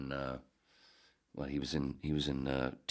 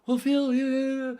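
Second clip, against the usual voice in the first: very high, loud screechy babble; nutty radio character; 10 dB louder than usual. Retold or imitated. Nutty character yelling over Phil on air, manic high shriek. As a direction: shriek it like an unhinged caller. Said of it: "one of his characters was just, you know, nuts".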